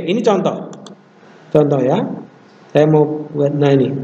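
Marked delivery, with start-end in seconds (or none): none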